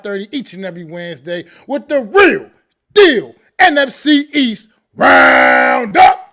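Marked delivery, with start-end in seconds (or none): 1.7-6.2 loud, drawn-out, booming title call; announcer's signature call. Shown as a demonstration. Co-host doing his own title right, full announcer shout. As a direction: belt out the show title, stretching each word. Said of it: "So much better than me".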